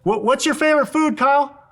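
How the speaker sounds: shouting across the room. Shown as a demonstration. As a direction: project to someone far away. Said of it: "He's shouting 'cause he's far away".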